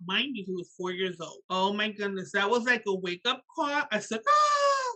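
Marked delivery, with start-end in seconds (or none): 4.3-4.9 high, loud "ah" of realization; acted yelp of sudden panic, pitched way up; alarmed cry. Mother getting a wake-up call, acted alarm.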